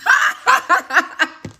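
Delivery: high, rapid burst of giggles; real high-pitched laugh; giggling laugh. Real, not acted. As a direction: crack up at your own joke. Laughs at own fake accent.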